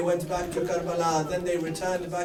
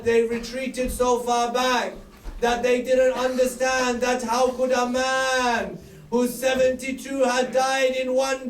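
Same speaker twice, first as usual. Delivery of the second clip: raised lament; 5 semitones higher than usual. Acted. Preacher recounting Hussein's battle, mournful rise. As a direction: build the battle story aloud.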